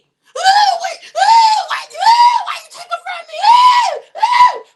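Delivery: over-the-top funeral crying, mocked; mock wailing. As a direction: wail like an overdone mourner. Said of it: "All that goddamn whimpering and crying".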